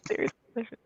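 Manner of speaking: whispered and breathy after a laugh, teasing the serious tone; mock-serious whisper. Real, not acted. Host echoes the serious topic to guests in a teasing whisper. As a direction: whisper it like a joke about being serious.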